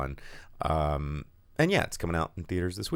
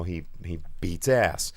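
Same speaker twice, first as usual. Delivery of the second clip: usual speaking voice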